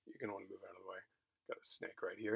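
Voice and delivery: calmly warning a colleague about a snake. Retold or imitated. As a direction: keep it low and unbothered, like a pro. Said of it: "And I very calmly just, you know, say".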